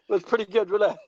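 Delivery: usual speaking voice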